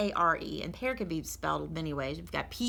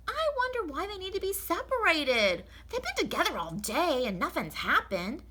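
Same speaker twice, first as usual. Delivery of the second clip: puzzled maid, much higher; Amelia Bedelia; 10 semitones higher than usual. Acted. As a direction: wonder aloud, innocently baffled. Amelia puzzles over eggs.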